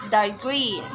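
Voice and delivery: dark green with GREEN stressed; dark green, weight on the second word. Shown as a demonstration. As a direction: lean on GREEN in dark green, then fall. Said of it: "stress is put on the second word".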